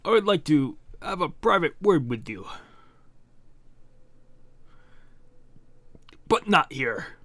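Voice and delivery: formal villain request in an affected voice; put-on character voice. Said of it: "What is that voice? I keep doing this voice".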